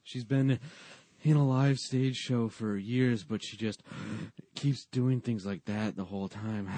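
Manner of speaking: loud mock inhales between phrases; weird mid-line breaths. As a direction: parody an actress gasping mid-line. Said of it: "takes weird breaths in the middle".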